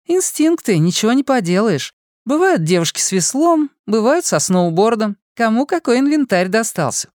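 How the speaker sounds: put-on sassy young woman lecturing lightly; bubbly sorority girl. A narrator's reading. Fortuna continues explaining to dog. (игривая девушка)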